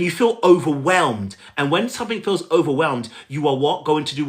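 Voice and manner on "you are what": ordinary delivery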